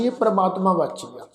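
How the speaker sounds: usual speaking voice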